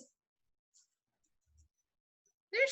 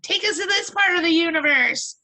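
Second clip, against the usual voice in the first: high, loud exasperated wife; a wife demanding why he set the GPS here; wife scolding; 8 semitones higher, 4 dB louder than usual. Acted. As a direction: snap at the husband who took the wrong route. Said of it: "She's like".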